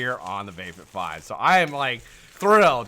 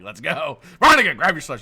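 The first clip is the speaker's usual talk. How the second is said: raised, hurried call to get moving; urgent retold call; 4 semitones higher, 10 dB louder than usual. Man rushing Veronica out, urgent raised voice. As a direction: call out urgently, reenacting the rush.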